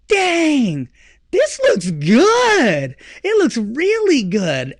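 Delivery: slow, drawn-out impressed voice; mock admiration. Husband admires the finished Ramada, slow and impressed. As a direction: savor the words.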